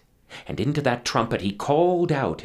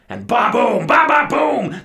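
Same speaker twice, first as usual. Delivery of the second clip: thunder boom; 12 semitones higher, 11 dB louder than usual. Acted. Imps blast thunder.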